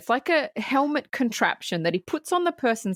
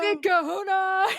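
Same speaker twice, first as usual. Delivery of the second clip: imitated villain's taunt; 9 semitones higher than usual. Host echoing the co-host's quote, hammed-up glee.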